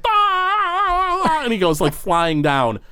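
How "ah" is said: long high-pitched comic cry; sustained falling "aaah"; tumbling ghost's wail. Acted. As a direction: wail as if rolling away down a hall. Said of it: "he just goes barrel rolling down the hall".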